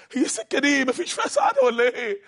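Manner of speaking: high breaking plea of "ولا إيه"; high desperate cry. Acted. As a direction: cry it out in despair. Repeated despairing question.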